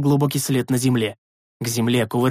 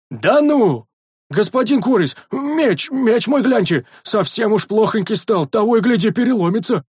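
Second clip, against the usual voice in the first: much higher, louder whining yell; pleading wail; 9 semitones higher, 4 dB louder than usual. A narrator's reading. The sentry wails to Koris, pleading over his sword. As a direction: wail up at the master. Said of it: "завопил дозорный".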